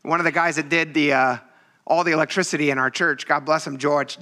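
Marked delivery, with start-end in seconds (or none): none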